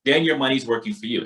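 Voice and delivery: normal talking voice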